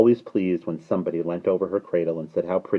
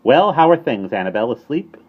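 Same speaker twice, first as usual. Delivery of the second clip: high, loud cheery greeting; jaunty, rising questions; perky bird; 7 semitones higher, 9 dB louder than usual. A narrator's reading. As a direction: chirp hello over the cradle. Starling greets the baby.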